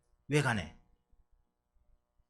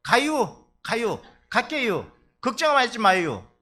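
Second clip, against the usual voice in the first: loud, higher breezy promise, slow in the middle; mock Chungcheong elder; 5 semitones higher, 7 dB louder, slower than usual. Preacher imitating the elder again, louder, carefree empty promise. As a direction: brush off the worry with a cheery yes. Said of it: "그러니까".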